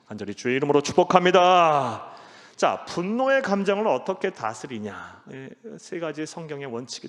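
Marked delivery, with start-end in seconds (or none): 0.1-2.1 fervent blessing. Acted. Pastor blesses the congregation.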